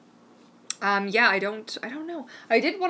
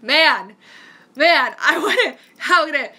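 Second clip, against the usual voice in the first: loud, higher-pitched, drawn-out exclamation; mock-envious outburst; 7 semitones higher, 10 dB louder than usual. Fan envying a YouTuber's gorgeous accent, mock-envious and loud. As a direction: burst out in playful frustration.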